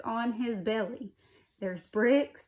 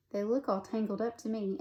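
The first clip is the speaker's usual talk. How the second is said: plain reading voice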